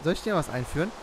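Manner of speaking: usual speaking voice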